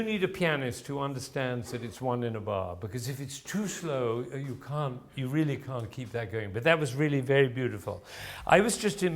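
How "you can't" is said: normal talking voice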